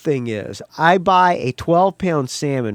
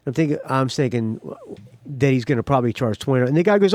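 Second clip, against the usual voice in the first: ordinary talk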